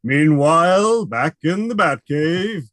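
slow, booming TV announcer; Bill Dozier narrator. Retold or imitated. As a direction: stretch it out like a dramatic TV narrator. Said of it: "in the style of Bill Dozier from the Batman T V series".

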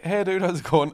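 a hint of a Pakistani accent on the greeting; half-hearted Pakistani accent impression. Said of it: "I don't wanna do an accent".

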